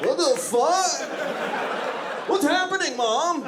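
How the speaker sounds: put-on be a scared child seeing news live; panicked kid.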